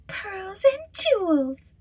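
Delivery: very high, slow, drawn-out spoiled voice; greedy stepsister. Acted. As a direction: stretch it out like a spoiled girl. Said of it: "said the second".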